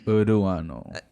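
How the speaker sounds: Uruano with an extra vowel before a tapped R; unrolled R workaround. Shown as a demonstration. Showing a friend how to manage without rolling, Uruano said slowly. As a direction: draw out Uruano, cheating the rolled R. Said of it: "if you can't roll your R's".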